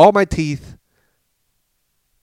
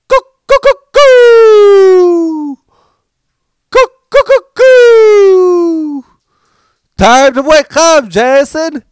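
high falsetto call, much louder, like yelling for help from a mountain top; mountain call wake-up; 12 dB louder, much slower than usual. Retold or imitated. Dad cupping his hands to wake his son: high, loud hooting call. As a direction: hands round the mouth, hoot it out high and loud. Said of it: "And he goes like this".

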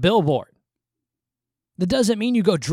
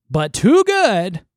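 TOO GOOD drawn out slow and loud; mocking, stretched-out "too good"; incredulous quoting; 3 semitones higher, 9 dB louder, much slower than usual. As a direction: lean hard and long on "too good" in disbelief. Quoting the post back in disbelief.

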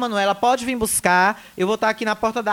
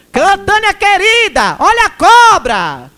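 slow, loud, high singsong "watch out"; mocking warning call; 9 semitones higher, 9 dB louder, slower than usual. Repeats the teasing warning.

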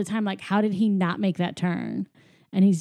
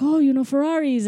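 excited; 7 semitones higher, 5 dB louder than usual. Retold or imitated. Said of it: "Luigi was very excited".

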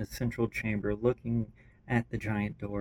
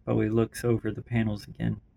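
usual speaking voice

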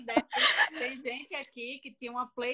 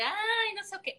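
mock girly whine; 11 semitones higher than usual. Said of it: "coisas nojentas pras meninas".

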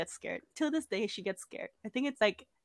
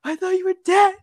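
high, louder mock sobbing; imitated crying; 7 semitones higher, 5 dB louder than usual. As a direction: blubber it like a tearful little sister. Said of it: "Are you crying?".

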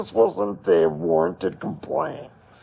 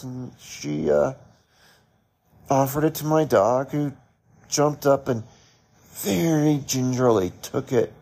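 ordinary talk

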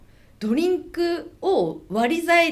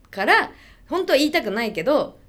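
ordinary delivery

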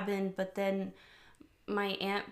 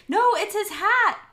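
loud, high-pitched excited outburst; excited realization; 12 semitones higher, 10 dB louder than usual. Real, not acted. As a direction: blurt out the correction, much higher and louder. Sudden realization corrects earlier guess.

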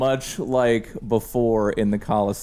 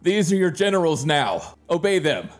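raised commanding decree; commanding villain; 7 semitones higher than usual. Acted. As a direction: order them like a conquering lord. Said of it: "and he says".

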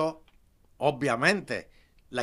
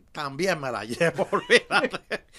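laughing boast; 2 semitones higher, 6 dB louder than usual. Real, not acted. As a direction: laugh through the joking boast. Joking about women he would take. (entre risas, fanfarroneando)

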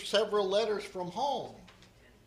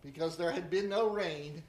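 scripture reading; 3 semitones higher than usual. Acted. Scripture reading, divine command.

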